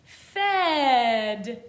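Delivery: loud, drawn-out sounding out; read slowly. Shown as a demonstration. Teacher sounding out a word for learners, slowly. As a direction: stretch Fed out slowly and loudly. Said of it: "Let's read it slowly".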